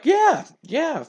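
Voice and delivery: high, hasty acted assurance; unconvincing reassurance. Trainer humoring his doomed friend, falsely reassuring. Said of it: "assuring his friend".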